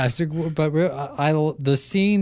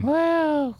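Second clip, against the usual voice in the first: mock whoa; 14 semitones higher than usual. Real, not acted. Reacting to mock wife.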